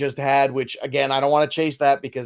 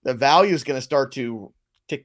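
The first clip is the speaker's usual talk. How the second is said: ordinary talk, nothing marked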